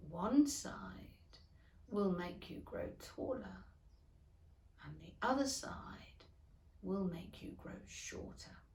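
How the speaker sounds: hushed, breathy asides; caterpillar whispering. A narrator's reading. Caterpillar leaving Alice with a whispered hint. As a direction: whisper it as you leave. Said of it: "merely remarking as it went".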